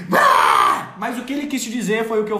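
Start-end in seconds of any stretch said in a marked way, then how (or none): 0.0-1.0 tantrum outburst. Acted.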